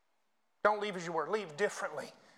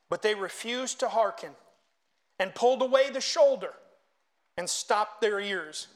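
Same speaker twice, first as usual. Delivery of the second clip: raised, ringing scripture reading; preached scripture reading; 3 semitones higher, 6 dB louder than usual. Acted. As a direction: read scripture out forcefully to a congregation. Reads scripture aloud to congregation.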